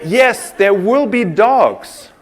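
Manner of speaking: ordinary talk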